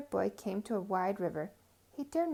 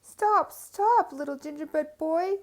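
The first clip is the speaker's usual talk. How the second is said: high, loud shout after him; old couple shouting after the runaway; 6 semitones higher, 7 dB louder, slower than usual. Acted. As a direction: yell stop as you chase.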